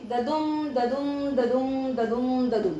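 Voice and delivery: ददुम chanted five times in an even, unbroken beat; iambic rhythm demo. Shown as a demonstration. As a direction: chant each ददुम as easy द, longer दुम. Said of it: "तो कैसे बोलेंगे". (ददुम की लगातार लयबद्ध रट)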